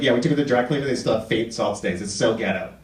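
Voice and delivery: low, lightly voiced spoiled suburban girl, whining. Comic quoting a rich suburban girl complaining about her car, lightly voiced. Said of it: "She's like".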